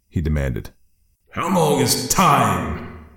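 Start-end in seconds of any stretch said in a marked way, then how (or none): 1.3-3.1 very slow, eerie spirit voice; ghostly warrior. Acted. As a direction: drag it out like a voice from the grave. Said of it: "spirit answers Leonard".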